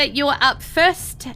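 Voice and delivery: normal talking voice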